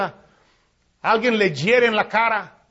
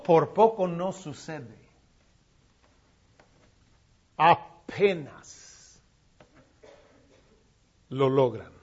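low, soft and slow; whisper-like awe; trailing off in quiet awe; hushed; 7 semitones lower, 6 dB quieter, slower than usual. Acted. Hushed closing near-miss.

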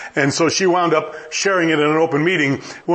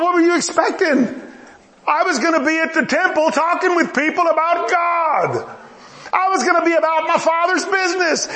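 exasperated son; 8 semitones higher than usual. Retold or imitated. Young Jesus protesting to his mother, high and incredulous. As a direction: push back like an exasperated boy. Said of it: "Jesus responds with".

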